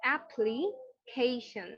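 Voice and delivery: অ্যাপ্লিকেশন slow with aspirated ক and -শন end; correct pronunciation. Shown as a demonstration. Teacher models অ্যাপ্লিকেশন to class, slow and careful. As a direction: model অ্যাপ্লিকেশন slowly and clearly. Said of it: "ফি এর উচ্চারণটা একটু agitated".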